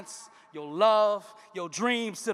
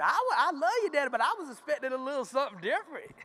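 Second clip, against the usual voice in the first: daughter's voice; 4 semitones higher, faster than usual. Acted.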